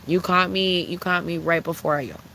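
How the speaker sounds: ordinary talk, nothing marked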